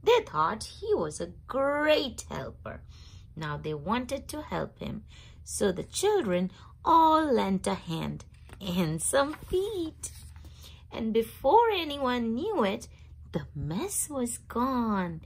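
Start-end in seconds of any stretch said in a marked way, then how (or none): none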